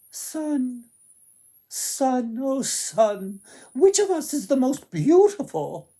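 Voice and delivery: high, loud pleading call to the sun; sing-song plea rising high and loud; sisters calling to the sun. Acted. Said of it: "and asked".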